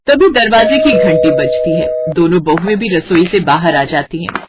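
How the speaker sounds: ordinary delivery, nothing marked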